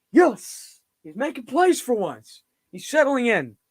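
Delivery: high-pitched burst of relief and joy; real excited cheering. Real, not acted. Fan cheering the quarterback finally making plays, excited. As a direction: cheer the play, voice jumping up high.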